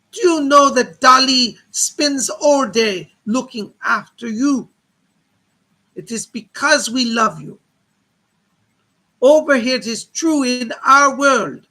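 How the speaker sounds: booming spirit speaking through a medium; Salvador Dali, seance medium spirit. Acted. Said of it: "It is Mister Salvador Dali".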